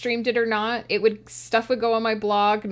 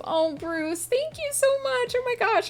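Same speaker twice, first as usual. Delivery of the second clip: choked up. Real, not acted. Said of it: "I'm gonna cry".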